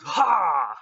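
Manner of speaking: short, loud burst of release; loud battle yell; attack shout. Acted. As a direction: fire the blast with one shout. Launching the Kamehameha.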